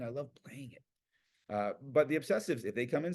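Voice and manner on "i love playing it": slow breathy whisper. Real, not acted. Speaker whispers her love of playing, slow whisper. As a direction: hush it like a secret joy. Whispered aside about loving piano.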